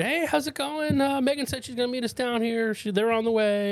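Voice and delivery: high-pitched, breezy imitation of a woman arriving; nonchalant. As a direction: chatter casually, totally unaware of any trouble. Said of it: "Just all, like, nonchalant".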